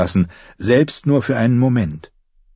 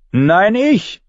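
high-pitched volunteering shout; eager call; 11 semitones higher than usual. A narrator's reading. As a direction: call out over the others, pitch jumping up. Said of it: "rief Katschek".

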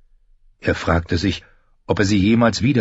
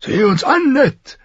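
very high-pitched, forceful king; big king's voice, pitched far up; Robert's voice; 4 dB louder than usual. A narrator's reading. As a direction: call to an old friend in a strained high voice. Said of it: "sagte Robert".

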